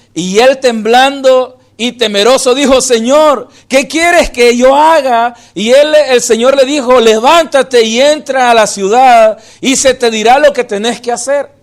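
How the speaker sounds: loud, emphatic Bible reading; preached reading aloud; forceful scripture reading. Acted. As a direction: proclaim the verse to the congregation.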